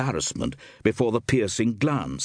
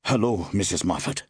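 ordinary delivery

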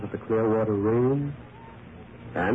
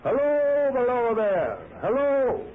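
calling out; 13 semitones higher, slower than usual. Acted. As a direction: call down to a stranger far below. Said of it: "I called again".

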